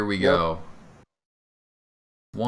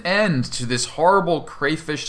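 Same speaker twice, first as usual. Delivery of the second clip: loud, raised, dramatic narration; game master narration; 5 semitones higher, 6 dB louder than usual. Acted. Game master describing the player's killing blow, dramatic narration. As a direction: announce the kill with relish.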